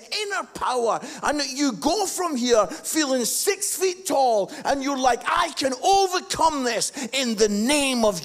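loud, high, rousing preacher's call; preaching at full force. Acted. Pastor rallying his congregation, loud full-force preaching. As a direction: lift the room with a ringing sermon voice.

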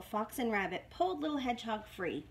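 ordinary delivery, nothing marked

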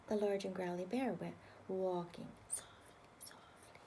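hushed storyteller whisper. A narrator's reading. Reader whispering the bear's sneaking to the child. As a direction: tiptoe the words in a whisper.

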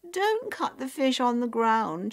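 high, loud petulant objection; frowning, fussy high voice; spoilt complaint. A narrator's reading. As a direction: whine it like a pampered sister.

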